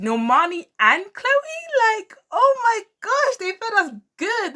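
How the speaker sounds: squealing fan rapture; gushing delight. Real, not acted. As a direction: swoon over how good they were. Gushing over a performance.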